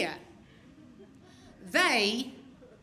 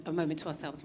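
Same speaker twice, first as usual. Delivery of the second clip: ordinary talk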